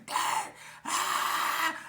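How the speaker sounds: high, loud shriek; retold scream. Storyteller acts out his screams while getting torn up, screaming. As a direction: yell out high as if being beaten. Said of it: "I'm screaming".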